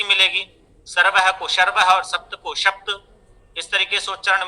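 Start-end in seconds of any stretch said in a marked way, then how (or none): 1.5-1.8 सर्वह said as sharvah. Shown as a demonstration. Teacher showing Bihar students' fault, s turned to sh. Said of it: "सकार का शकार रूप से उच्चारण". 2.5-3.0 सप्त said as shapta. Shown as a demonstration. Teacher showing Bihar students' fault, शप्त for सप्त. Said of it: "सकार का शकार रूप से उच्चारण".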